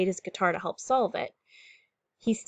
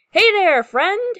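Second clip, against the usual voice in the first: high, loud friendly holler; storybook hail to a faraway friend; captain's yell; 12 semitones higher, 11 dB louder, slower than usual. A narrator's reading. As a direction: yell it across the waves. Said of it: "he yells to the sea monster".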